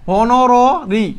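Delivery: হনরারি drawn out slowly, 'ho-no-ro-ri'; syllable-by-syllable pronunciation. Shown as a demonstration. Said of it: "এটাকে আমরা বলে কি". (ধীরে ভেঙে 'হ-ন-র-রি' উচ্চারণ)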